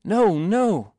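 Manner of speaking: high, loud protest. A narrator's reading. Boy refusing the honour of the shield, protesting. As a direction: refuse urgently, voice jumping up. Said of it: "he protested".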